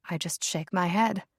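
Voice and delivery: plain reading voice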